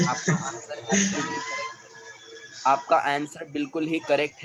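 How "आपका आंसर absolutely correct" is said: high, slow, drawn-out mock cheer; exaggerated praise. Teacher teases the class, mock praising student's answer. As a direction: ham up the praise with a laugh.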